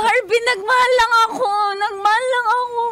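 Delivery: put-on high, loud heartbroken drunk sob; drunk wailing. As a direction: wail it out, tipsy and heartbroken.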